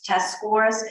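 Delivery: ordinary delivery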